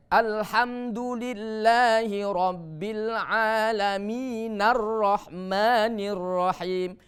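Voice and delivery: slow chant linking "Alamin" into "Ar-Rahmanir" as "nar-rahmanir"; joined recitation demo. Shown as a demonstration. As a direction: recite in one flow, hamzah of "Ar-Rahmanir" silent. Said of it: "Bukan Ar-Rahman lagi". (bacaan wasol: "Alamin" disambung ke "Ar-Rahmanir")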